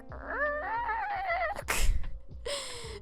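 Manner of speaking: very high, thin, held squeal of pain; a long choked-up squeak; strained squeal. Retold or imitated. Jeff screaming inside while hugged.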